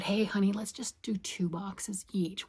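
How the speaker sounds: joking whisper. Retold or imitated. Wife retelling a joke to her husband, sly whisper. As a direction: murmur it like a sly suggestion to your husband. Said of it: "joking the other day".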